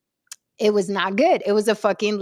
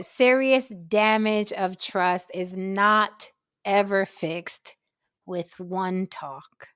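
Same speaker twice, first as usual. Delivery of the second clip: slow, measured reading, dropping low at the end; solemn pronouncement; 3 semitones lower, 4 dB quieter, much slower than usual. Acted. Reading a warning out to the gentlemen, solemn and slow. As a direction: lay it down gravely, word by word.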